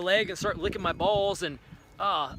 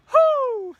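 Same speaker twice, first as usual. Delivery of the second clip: loud, very high whoop of delight; excited whoop; 10 dB louder than usual. Pretend. Starving man spotting a fruit tree, whooping loudly. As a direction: let out a joyful whoop. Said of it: "I'm like".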